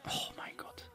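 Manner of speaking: breathless whisper of amazement; awed whisper. Real, not acted. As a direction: hush it out in disbelief. Awed whisper at rare pack items. (ehrfürchtiges Flüstern)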